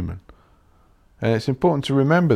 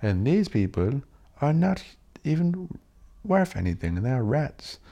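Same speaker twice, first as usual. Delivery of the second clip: lightly voiced dictator, higher pitch; Hitler; 6 semitones higher than usual. Comic telling how fascism flatters followers, lightly imitated dictator. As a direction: lightly imitate a leader rallying his chosen people. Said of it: "Hitler was like".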